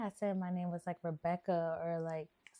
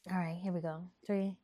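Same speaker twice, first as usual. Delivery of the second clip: ordinary delivery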